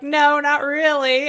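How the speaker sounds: mock mopey answer. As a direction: play the glum reply for laughs. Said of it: "And you're like".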